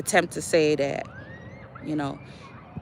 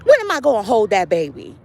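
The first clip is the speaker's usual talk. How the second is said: playful scolding; 4 semitones higher, 6 dB louder than usual. Retold or imitated.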